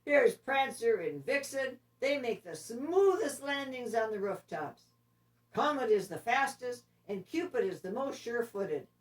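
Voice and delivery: proud Santa announcing his picks. Acted. Santa names the team to the reindeer, hearty and loud. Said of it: "said Santa".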